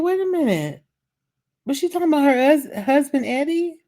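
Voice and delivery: very high-pitched retold surprise; high-voiced mock bewilderment; puzzled disbelief. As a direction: question it in a high, baffled squeal. Retold puzzled reaction to trailer.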